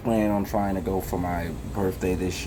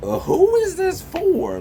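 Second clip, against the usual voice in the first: much higher, louder and slower, baffled; mock bafflement; 6 dB louder than usual. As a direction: act stunned at the billboard. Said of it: "and I was just like".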